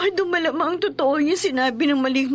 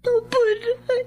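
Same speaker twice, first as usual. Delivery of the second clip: slow, tearful, high reply; 6 semitones higher, slower than usual. Acted. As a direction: answer through tears. Frightened daughter answers mother.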